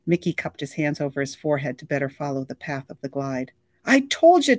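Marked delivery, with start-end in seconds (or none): 3.8-4.6 high, loud burst of glee; bursting with excitement, proud she was right; excited. A narrator's reading. Girl telling her friend excitedly. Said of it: "Tuna said excitedly".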